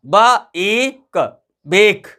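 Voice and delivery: "बा, ए, क" separately, then blended "बेक"; sounding out. Shown as a demonstration. Teacher demonstrates long A by blending "बा, ए, क" into "बेक". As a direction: sound each part, buh, long ay, kuh, then join into "बेक". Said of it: "ए का उच्चारण ए आया".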